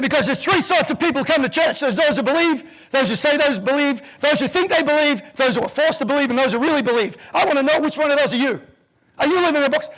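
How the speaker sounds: high, loud sermon voice; the reverend rousing the congregation; fiery preacher. Retold or imitated. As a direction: preach it to the whole church.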